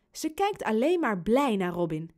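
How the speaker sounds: ordinary delivery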